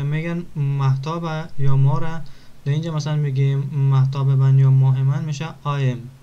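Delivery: ordinary delivery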